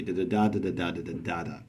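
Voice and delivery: fast, soft, rattling off the beats; fast scanning. Shown as a demonstration. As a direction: rush through the anapests. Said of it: "unstressed syllable at the end".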